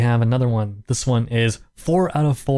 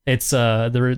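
ordinary talk, nothing marked

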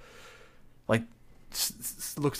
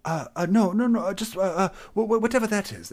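high, stammering nervous greeting; timid satyr voice; 11 semitones higher, 7 dB louder, faster than usual. Acted. To the tavern crowd, a high, stammering shy hello. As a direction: squeak out a shy hello at the bar.

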